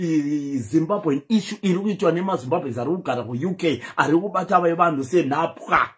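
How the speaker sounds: ordinary talk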